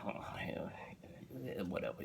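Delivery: unvoiced, bored brush-off; bored whisper. Husband whispers a bored brush-off to his excited wife. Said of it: "looking like".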